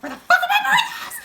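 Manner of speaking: laughing imitation of a panicked worker; laughing mock yell. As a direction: shriek it through a laugh like a frazzled cook. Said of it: "you could tell that she's like".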